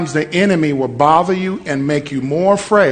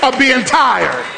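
preaching at full force; 5 semitones higher than usual. Acted. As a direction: preach it with rising power. Preaching crescendo against devil.

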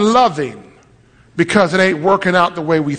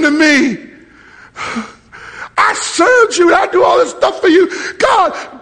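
high-pitched, anguished shouting; angry yell at God; 13 semitones higher than usual. Retold or imitated. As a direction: cry out in outraged desperation. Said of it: "I yelled at him".

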